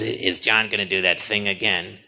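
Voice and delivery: low put-on voice; Harvey Fierstein. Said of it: "he goes, he goes".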